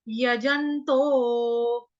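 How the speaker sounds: यजंतो stretched out very slowly; slow yajanto. Shown as a demonstration. Teacher says यजंतो slowly for class. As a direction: draw out यजंतो syllable by syllable. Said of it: "comma यानी कि pause लेंगे".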